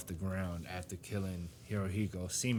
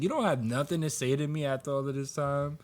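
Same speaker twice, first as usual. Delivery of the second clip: mock indignant daughter; 6 semitones higher, 3 dB louder than usual. Acting out daughter confronting her father.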